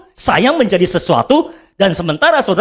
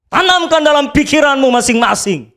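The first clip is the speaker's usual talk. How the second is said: ordinary delivery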